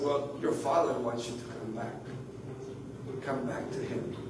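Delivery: soft, low and unhurried; tender reassurance. Retold or imitated. Pastor retelling how he called her back, tender reassurance. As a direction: reassure gently, letting the voice sink. Pastor consoling woman in retold talk.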